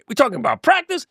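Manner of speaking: hammed-up Iverson, voice jumping up on practice; Allen Iverson quote. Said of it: "I'm Allen Iverson".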